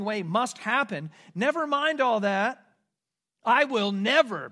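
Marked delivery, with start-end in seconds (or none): 3.4-4.5 louder, slow, stressed promise; emphatic vow. Acted. Peter insisting to Jesus, loud slow vow. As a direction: lean hard on every word. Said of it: "Peter says".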